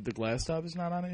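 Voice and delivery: ordinary talk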